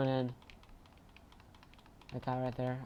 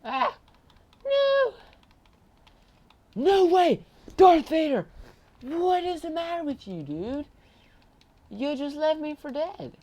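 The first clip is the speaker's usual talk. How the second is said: outraged yell; 12 dB louder, slower than usual. Real, not acted. Betrayed by teammate in game.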